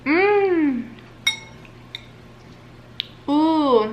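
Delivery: high, loud tasting sounds; delighted mmm and ooh. Real, not acted. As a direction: relish each bite audibly. Tasting the dish.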